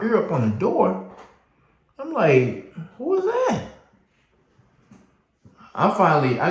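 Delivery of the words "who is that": much higher, strained questioning; suspicious who is that. Retold or imitated. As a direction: press to hear who it is. Ear against the door.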